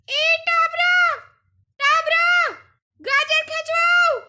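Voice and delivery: high, loud cat-voiced call. A narrator's reading. The cat calls the mouse to help, high loud call. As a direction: call the mouse in a squeaky shout. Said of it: "પશીએ બૂમ પાડી".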